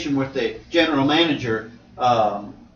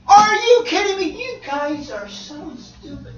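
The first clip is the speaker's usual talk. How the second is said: mock scorn; 11 semitones higher, slower than usual. Voicing scornful onlooker.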